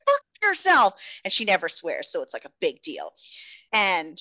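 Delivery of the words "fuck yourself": high, fast, exasperated outburst; acted high-pitched furious yell; frustrated yelling. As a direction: yell it high and rushed, at wit's end. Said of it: "she just starts yelling at him".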